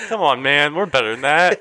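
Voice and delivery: retold, hammed-up disappointment; mock exasperated pleading. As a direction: plead with your dad, half laughing. Retold scolding of their dad.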